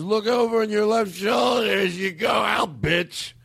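high, slow mock tirade; angry uncle. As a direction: imitate a drunk uncle's bitter parting shot. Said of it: "that's the anger".

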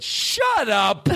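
half-laughing mock telling-off; laughing shut up. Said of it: "you tell an eighty-year-old".

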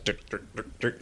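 breathy whispered ticking; whispered sound effect. Retold or imitated. Fan imitating Eclipso strutting on stage, whispered sound effect. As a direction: hiss it like a creepy footstep noise.

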